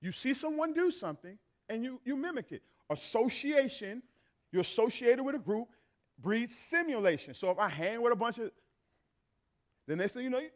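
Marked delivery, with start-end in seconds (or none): none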